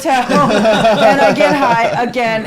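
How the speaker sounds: laughing loudly through the confession; a real burst of laughter on the words. Real, not acted. Laughing as she tells him she relapsed.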